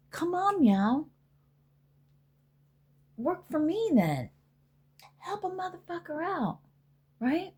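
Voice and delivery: sing-song teasing plea, slowed on work for me; playful pleading. Retold or imitated. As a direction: coax the spirits like a cat begging. Pleading with spirits.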